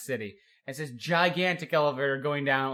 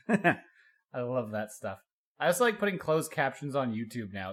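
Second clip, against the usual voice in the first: usual speaking voice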